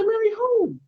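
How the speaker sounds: high-pitched, startled question; mock incredulous. Man retelling his reply to God, mock incredulous. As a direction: jump up high in disbelief, falling on WHO.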